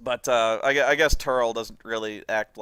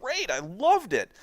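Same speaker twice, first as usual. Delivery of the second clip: insistent; 8 semitones higher, 3 dB louder than usual. Retold or imitated. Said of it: "Doug has always insisted".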